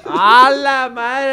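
exclamación lenta y fuerte; sorprendido. Real, not acted. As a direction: alargando la sorpresa. Reacción a las seis de la mañana. (sorpresa exagerada)

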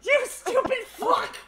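high squeal through laughter; hysterical exasperation. Real, not acted. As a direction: wail helplessly while laughing. Helpless at driver's blunder.

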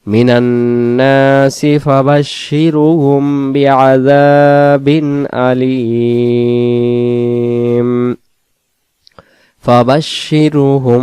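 slow melodic tajweed chant, long held vowels; chanted Quranic recitation. Shown as a demonstration. Teacher recites the verse.

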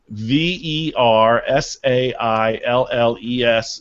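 spelled out letter by letter, slowly; slow careful spelling. Shown as a demonstration. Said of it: "spelling it out".

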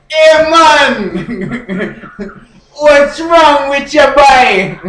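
put-on loud, high, drawn-out Jamaican accent; yelling Jamaican. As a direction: holler like a comic Jamaican. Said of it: "why are you yelling?".

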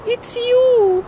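high put-on woman's voice, delighted; relieved girlfriend. Alex recognising Steve, happy and surprised. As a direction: gasp it out in glad surprise.

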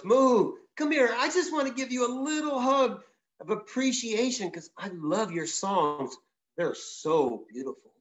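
high, coaxing bear voice wheedling a hug; a big bear sweetly luring the bird; sweet-talking bear. Acted. Storyteller voices the grizzly luring the songbird, high and coaxing.

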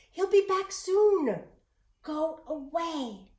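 put-on an old woman urgently turning the boy away; old woman warning. A narrator's reading. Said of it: "she whispered".

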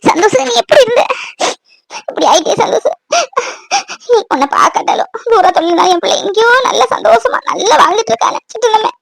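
high, broken crying voice; tearful mother. Acted. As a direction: sob through the words to a long-absent son. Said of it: "அழாதீங்க mummy".

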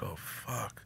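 barely audible stunned murmur; tiny, awed whisper of a curse; hushed dread. Retold or imitated. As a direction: let it out under your breath. Retold dread as view zooms out.

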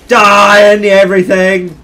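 slow, high, full-force shout; battle yell. Real, not acted. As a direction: yell it at the enemy in the heat of play. Yelling at opponents in game.

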